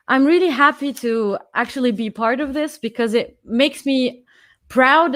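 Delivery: ordinary talk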